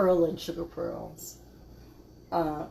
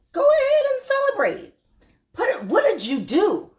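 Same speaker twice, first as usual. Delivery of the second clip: much higher, louder, emphatic cheer; excited urging; 13 semitones higher, 6 dB louder than usual. Real, not acted. Host urging viewers to go ahead and celebrate, excited. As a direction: hype them up, pitched way up.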